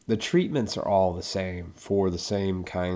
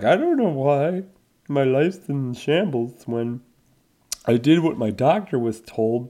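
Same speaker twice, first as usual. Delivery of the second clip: higher, exaggerated mock lament; put-on woe-is-me voice, pitched up; mock complaining; 5 semitones higher, 3 dB louder than usual. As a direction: imitate clueless people bemoaning their lot. Mocking people who wonder why.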